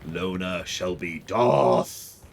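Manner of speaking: slow, solemn mock prophecy voice; hammed-up dark lord pronouncement; mock Sith lord. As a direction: drag out the naming like a dark oracle. Mock Sith naming ceremony.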